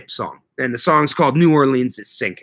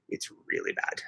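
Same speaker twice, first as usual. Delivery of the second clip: whisper; 16 dB quieter than usual. Real, not acted.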